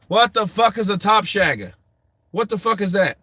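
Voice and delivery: high, loud incredulous question; exasperated bafflement. Real, not acted. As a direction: demand an answer in comic disbelief. Baffled by unknown slang from chat.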